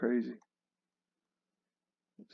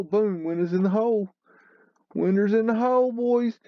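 mock triumphant; 9 semitones higher than usual. Mock triumph at sunken windows.

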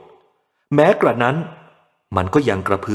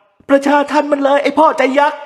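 much higher, louder outrage; angry mob cry; 5 dB louder than usual. A narrator's reading. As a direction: rouse the crowd against him. Imagined mob cries out.

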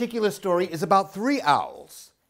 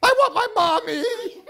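put-on high, louder whimpering baby voice; baby owl crying for his mother; 6 dB louder than usual. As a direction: wail it like a tiny owl wanting Mommy. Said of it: "cried Bill".

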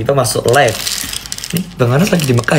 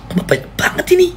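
very high, squeaky disbelief; disbelieving squeal; faster than usual. Real, not acted. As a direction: squeal at the flood of gifts. Flood of gifts keeps coming. (nada melengking tinggi tak percaya)

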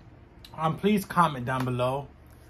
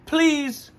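pleading; 12 semitones higher, 5 dB louder than usual. Real, not acted. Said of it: "I beg of you".

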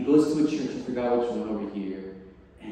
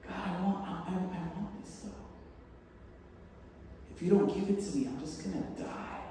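whiny plea; 5 semitones higher, 7 dB quieter than usual. Retold or imitated. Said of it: "mock whiny prayer of obsession".

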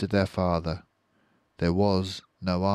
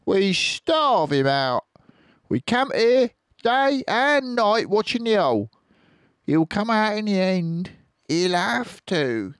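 high, louder scheming plan; high farmer voice; 6 dB louder than usual. Acted. Bean to the farmers, squeaky scheming plan.

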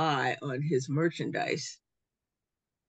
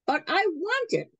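put-on whiny girl; 12 semitones higher than usual. Girl stamps her foot.

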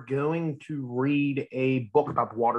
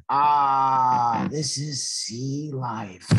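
contented sigh; much slower than usual. Acted. Drop sunbathing on the sea, slow blissful sigh. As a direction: sink into it like lying in the sun.

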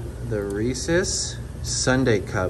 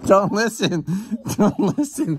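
laughing; 8 semitones higher, 4 dB louder, slower than usual. Real, not acted. Laughing denial to viewers.

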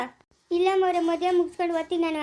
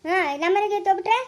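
usual speaking voice

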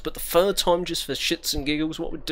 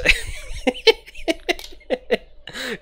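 high squealing laugh; 14 semitones higher than usual. Real, not acted. Gamer laughing at the rigged game.

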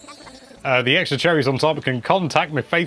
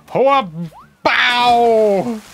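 loud hammy shouts; 10 semitones higher, 6 dB louder than usual. Pretend. Hitting the fish.

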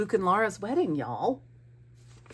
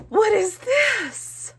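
high, loud, stretched-out squeal; excited squeal; 7 dB louder, slower than usual. Real, not acted. Vlogger discovers a surprise from friend, excited squeal. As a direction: squeal slowly with astonished delight.

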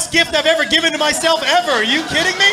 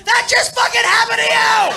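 high, loud, incredulous yell; mock stunned shout; 11 semitones higher, 5 dB louder than usual. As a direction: shout it at the fallen friend. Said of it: "ran up to him and went".

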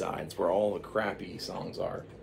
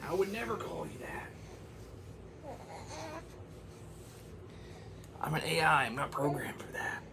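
high, softening falsetto; high defensive falsetto fading to a whisper; 10 semitones higher, 6 dB quieter than usual. Acted. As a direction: protest innocently in a high voice. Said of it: "Madonna was like".

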